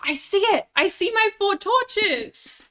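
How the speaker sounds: high, loud excited cry; excited discovery. Real, not acted. Lost gamer spotting own torches, excited cry. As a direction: call it out in sudden excitement. Gamer spots the thing searched for.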